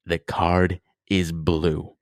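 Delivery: very slow, measured retold guess; mock-momentous, spaced-out answer; deliberate bluff. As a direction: announce it word by word, drawn way out. Retold bluff guess to hotel lady.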